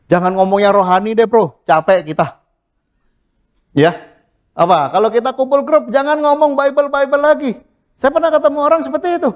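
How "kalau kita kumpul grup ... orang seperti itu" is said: high mocking voice of a bored friend; mocking complainer. Preacher mimics a friend who is tired of Bible talk, high and mocking. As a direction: mimic a whiny friend, pitched up.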